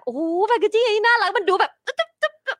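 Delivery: high-pitched cooing over something cute; gushing delight. Acted. Host acts out delight at steak packaging, gushing.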